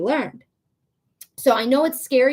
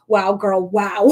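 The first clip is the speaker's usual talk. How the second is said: ordinary delivery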